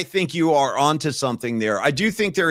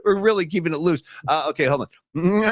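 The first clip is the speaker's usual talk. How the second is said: normal talking voice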